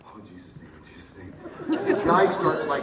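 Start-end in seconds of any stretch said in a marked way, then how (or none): 0.1-1.3 very soft, low praying under the breath; hushed, low invocation; murmured prayer. Retold or imitated. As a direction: pray so the judge barely hears. Praying under his breath in court.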